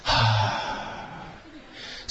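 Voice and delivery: just a quiet puff of air; short soft exhale. Shown as a demonstration. Teacher showing the relaxed out-breath, softly. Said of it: "吸って".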